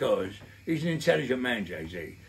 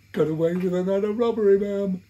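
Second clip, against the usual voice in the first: higher, louder cheeky lad; a hammed-up proud young tearaway; mock proud boast; 10 semitones higher, 8 dB louder than usual. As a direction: boast to mum in a chirpy put-on voice. Mates' proud boast to mum.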